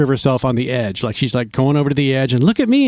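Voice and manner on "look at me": lightly mimicked selfie girl, higher pitched. Selfie girl at the cliff edge, lightly mimicked higher voice. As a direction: pitch it up for an attention-seeking poser.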